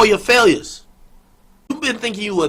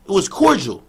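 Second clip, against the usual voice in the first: ordinary talk, nothing marked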